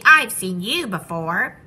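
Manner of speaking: loud, slow astonishment; taken aback, pushing the words out; gasped recognition. A narrator's reading. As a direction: stretch it out in surprised recognition. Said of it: "gasped Maisie".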